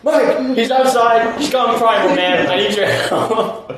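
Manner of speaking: high-pitched, panicky call for help; imitated urgent plea. Waking Mike to help with the primal friend, imitated urgent plea. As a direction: call out in comic alarm.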